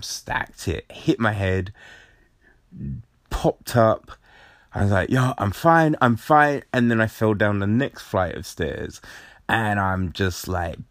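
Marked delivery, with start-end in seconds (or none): 5.1-6.6 loud, raised reenacted reassurance; hurried mock insistence after a fall; insisting he's fine. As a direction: call it out high, as if waving off help. Acted reassurance after falling down stairs.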